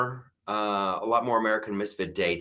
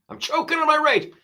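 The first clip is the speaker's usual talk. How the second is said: much higher, strained choked squeak; mock strangled rage; faster than usual. Shown as a demonstration. Host jokes about wildfire anger, high strained mock choke. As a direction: squeeze it out high like choking on fury.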